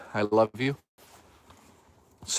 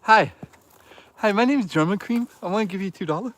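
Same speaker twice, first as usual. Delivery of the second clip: lightly higher put-on goofy voice; mock donor voice; 6 semitones higher than usual. Streamer repeating a chat joke in a goofy voice. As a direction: parody a chat donor introducing himself. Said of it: "That's my new favorite joke".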